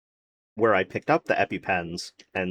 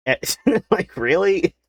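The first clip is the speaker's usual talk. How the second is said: higher, louder laughing disbelief; incredulous laugh; 9 semitones higher, 4 dB louder than usual. Real, not acted. Venting about the cancelled evaluation, laughing in disbelief. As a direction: laugh it out, can't believe it.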